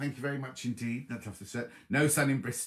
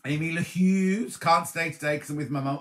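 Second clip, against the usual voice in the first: ordinary talk, nothing marked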